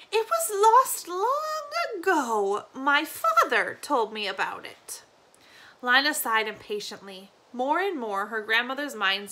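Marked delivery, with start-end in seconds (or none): 0.1-5.0 put-on slow, high then whispered old woman; wistful granny. Granny recalling her father to Lina, wistful. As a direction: remember it from far away.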